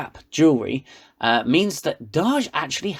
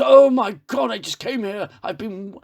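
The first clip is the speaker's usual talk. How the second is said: mock Picard; 5 semitones higher than usual. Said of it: "And Picard goes".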